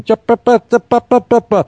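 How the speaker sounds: high mock chattering sounds; chatter noise. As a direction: imitate a mouth that never stops. Imitating chattering mouth.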